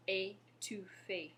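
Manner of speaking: "Étouffé" slowly, syllable by syllable; careful French pronunciation. Shown as a demonstration. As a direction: model "Étouffé" for repeating, ay-too-fay, drawn out. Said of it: "Go ahead and say that".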